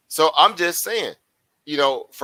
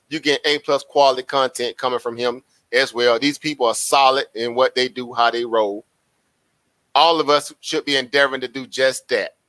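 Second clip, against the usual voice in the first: usual speaking voice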